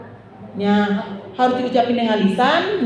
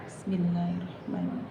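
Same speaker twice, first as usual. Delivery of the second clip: murmured basmalah; barely voiced, to yourself; soft recitation; 3 semitones lower, 14 dB quieter than usual. Shown as a demonstration. Teacher models quiet recitation for the class. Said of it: "asal kedengeran kuping aja".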